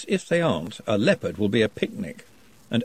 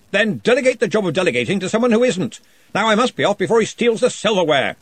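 louder, higher, hearty interrogation; booming wizard questioning; 9 semitones higher, 4 dB louder than usual. Acted. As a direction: press the shy goblin with jovial authority. Ridcully leaves in haste.

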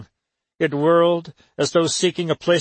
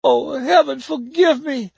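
moaning plea; 11 semitones higher than usual. A narrator's reading. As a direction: moan it with dread. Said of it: "Aunt Maggie moaned".

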